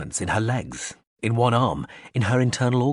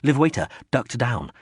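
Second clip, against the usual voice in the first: usual speaking voice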